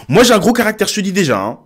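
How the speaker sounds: high-pitched parody of a self-declared strong character; mock feisty woman. Reactor mimicking a dating candidate's boast, mock feisty. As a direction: pitch it up, playing her announcing herself. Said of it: "Si une femme dit".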